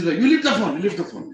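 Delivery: high, loud burst, easing on the repeat; exasperated order. Acted. Hungry husband tells wife to lift the phone, exasperated order.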